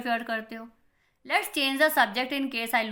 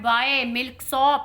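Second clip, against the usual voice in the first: angry outburst; 7 dB louder, much slower than usual. Acted. Chubukov insults Lomov in quarrel.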